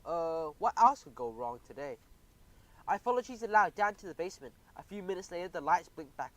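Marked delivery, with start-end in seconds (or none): 0.0-2.0 slow, worried and loud; shaken despair. Acted. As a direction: dread what comes next. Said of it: "I was still shaking".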